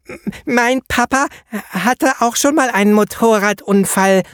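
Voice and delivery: put-on high child voice with a stutter; little boy stammering. A narrator's reading. Norbert telling the painter about his dad, high child voice. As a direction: pipe up shyly as a small boy. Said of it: "meldete sich Klein Norbert".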